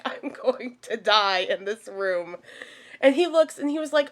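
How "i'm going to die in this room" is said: quiet dread. Retold or imitated. Telling viewers her frightened thought, quiet mock dread. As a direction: say it softly, slowly, as if doomed. Retelling her fear in the room.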